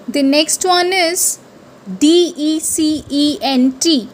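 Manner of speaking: ordinary delivery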